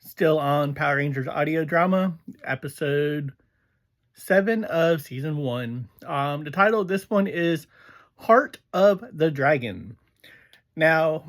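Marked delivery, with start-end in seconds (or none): none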